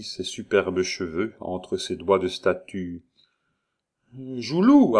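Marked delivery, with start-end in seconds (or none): 4.4-5.0 high, loud call of a name. Acted. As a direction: summon someone from the next room. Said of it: "appela-t-elle". (en appelant)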